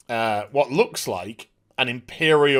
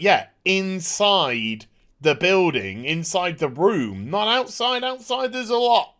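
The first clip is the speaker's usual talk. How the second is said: pointed correction; 4 semitones higher than usual. Retold or imitated. Trooper correcting the stormtrooper count, pointed correction. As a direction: draw out each phrase, then jump up in pitch.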